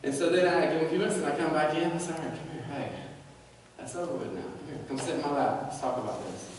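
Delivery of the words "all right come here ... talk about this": soft, much quieter, gentle; quiet comforting father voice; tender parent. Retold or imitated. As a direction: coax a crying child to your lap. Voicing tender parent to child.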